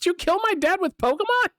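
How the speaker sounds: high squeaky imitation of the character; high mock-accusing voice. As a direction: mimic him in a high mocking squeak. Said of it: "He's like".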